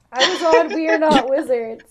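loud high laughing correction. Real, not acted. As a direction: laugh through the words. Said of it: "I'm falling apart".

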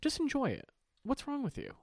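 high-pitched incredulous scolding; mock exasperation. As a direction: plead in a squeaky high voice.

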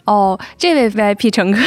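ordinary talk, nothing marked

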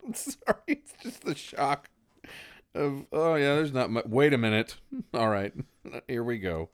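holding back laughter; fighting off the giggles; stifled giggles. Real, not acted. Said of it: "I'm trying not to laugh".

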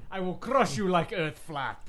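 slow, loud, laughing Bulgarian brute; hammed-up slow strongman threat with laughter; barbarian. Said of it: "Rusev to combat with".

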